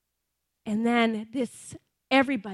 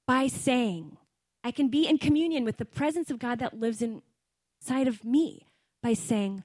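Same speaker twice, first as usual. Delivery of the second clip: usual speaking voice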